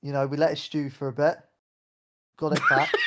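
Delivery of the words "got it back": high joke voice. Pretend. Joking about cooking a baby.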